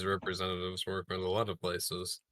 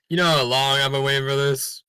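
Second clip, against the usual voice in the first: excited; 4 semitones higher, 8 dB louder than usual. Real, not acted. Eager to loot the office.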